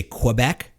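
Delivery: QUEBEC as kwuh-BEC, questioning. Acted. Challenging the co-host on Quebec, said with a W as kwuh-BEC. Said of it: "you throw a W in there".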